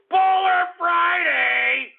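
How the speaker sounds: high, stretched-out show-name call; hype announcer. Acted. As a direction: sing out the segment name like a radio jingle.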